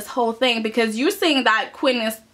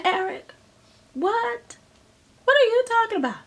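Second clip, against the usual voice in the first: much higher, mock-incredulous; shocked what; 11 semitones higher than usual. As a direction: sound stunned at the news. Family reacting to Eric's plan.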